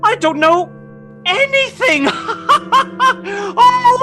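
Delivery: high-pitched, laughing delight; overjoyed, laughing out the words; giddy joy. Acted. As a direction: burst into high, gleeful laughter. Scrooge overjoyed to be alive.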